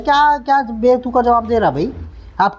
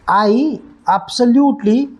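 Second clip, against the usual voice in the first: drawn-out stress; 4 semitones higher, much slower than usual. Shown as a demonstration. Teacher modelling the sentence slowly, stretching absolutely. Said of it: "teacher demonstrates stretched word".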